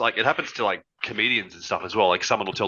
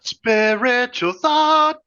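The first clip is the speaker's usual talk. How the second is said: spooky voice; 7 dB louder, much slower than usual. Pretend. Friend joins spooky voice.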